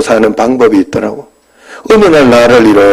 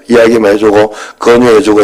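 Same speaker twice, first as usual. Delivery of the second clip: usual speaking voice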